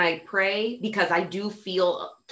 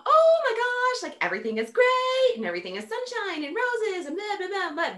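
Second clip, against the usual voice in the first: mock the everything-is-sunshine act; high sing-song fake cheerfulness; fake positivity; 13 semitones higher than usual. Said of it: "that emotional roller coaster".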